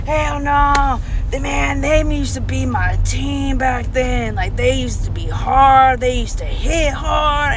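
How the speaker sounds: put-on a grandpa ranting about his old team; going off about football. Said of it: "and just going off".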